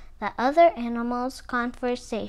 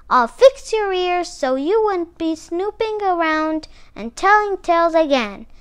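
higher, louder threat from Alligator; scolding threat; 4 semitones higher, 6 dB louder than usual. A narrator's reading. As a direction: threaten punishment like an angry scold. Alligator threatens Armadillo while scolding.

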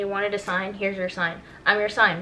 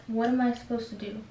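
usual speaking voice

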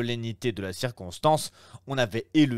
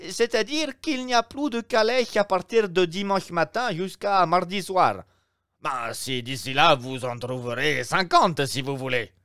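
higher, louder obsequious innkeeper voice; innkeeper, fussy; 8 semitones higher, 4 dB louder than usual. Acted. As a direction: play the eager Roman host, pitched up. (aubergiste empressé, voix plus aiguë et plus forte)

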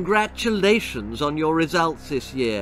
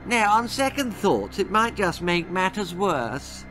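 smug teasing; 7 semitones higher than usual. A narrator's reading. The villain teases the boy.

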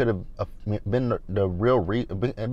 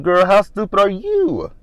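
high, loud, drawn-out disbelief; hammed-up incredulous scolding; acted exasperation; 10 semitones higher, 6 dB louder than usual. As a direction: stretch it out like you cannot believe her. Acted exasperated reply to castmate.

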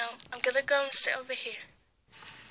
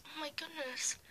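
frightened whisper; 3 semitones higher, 13 dB quieter than usual. Acted. Frightened gasp at story.

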